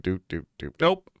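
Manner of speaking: ordinary talk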